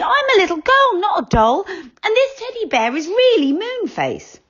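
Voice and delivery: put-on a small girl insisting eagerly; little girl character, very high; little girl Franny. A narrator's reading. Franny speaks.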